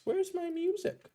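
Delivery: stretched high whine over lost music; whiny voice. Real, not acted. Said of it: "hear my whiny voice".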